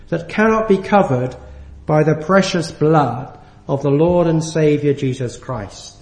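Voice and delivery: ordinary delivery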